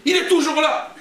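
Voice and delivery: high-pitched mock protest; retold indignant objection; acted indignation.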